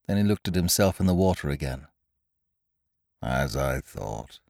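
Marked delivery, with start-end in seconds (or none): 3.2-4.4 low, slow, sighing Eeyore voice; gloomy donkey. A narrator's reading. Old grey donkey sighs at his reflection, low and gloomy. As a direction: mope it out, dragging each syllable. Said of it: "said Eeyore gloomily".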